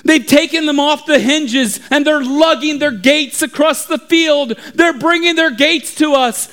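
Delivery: high-pitched frantic report; alarmed lookout. Acted. Lookout tells the town about the gates, alarmed. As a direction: report disaster in rising alarm. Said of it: "he began to scream".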